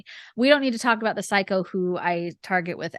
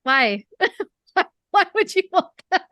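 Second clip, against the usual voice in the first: higher, laughing in disbelief; baffled laughing why; 8 semitones higher, 3 dB louder than usual. Retold or imitated. Author retelling her reply to a would-be reader, laughing. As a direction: laugh through a baffled question. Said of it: "'cause it makes me uncomfortable".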